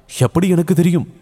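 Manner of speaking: high, puzzled princess voice; questioning retort. A narrator's reading. Princess asking how she would know, querying.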